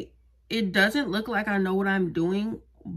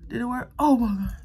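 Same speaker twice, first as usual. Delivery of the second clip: usual speaking voice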